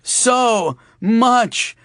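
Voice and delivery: high, slow, drawn-out moaning plea; hammed-up dying man; faked agony. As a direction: stretch out the pain. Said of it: "fake pain".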